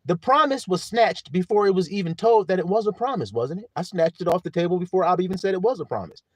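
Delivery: ordinary talk, nothing marked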